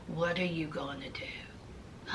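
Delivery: breathy, hushed and slow; flat whispered question. Retold or imitated. Eric asking Puppy about the baby, whispered flatly. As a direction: whisper it coldly. Eric's cold question, retold.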